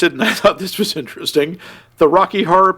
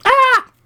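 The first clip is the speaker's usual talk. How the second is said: short scream; 4 dB louder than usual. Acted. Scream after trailer line.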